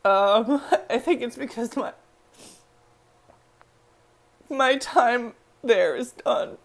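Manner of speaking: high, choked-up voice with long pauses; emotional. Real, not acted. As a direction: fight back real emotion as you speak. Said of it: "I'm getting emotional".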